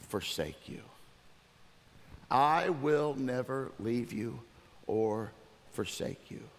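low, measured reading with spaced words; deliberate and soft, weighing each phrase; solemn scripture reading. Real, not acted. Pastor closing with Hebrews scripture, solemn and measured.

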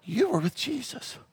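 put-on high, soft little girl's voice; small, soft voice of a thirteen-year-old maid; little maiden voice. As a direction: play a timid young servant girl. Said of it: "She's got a little voice".

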